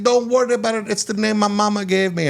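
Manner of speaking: high-pitched mimicked reassurance; imitating resort man. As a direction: brush off worries in his high voice.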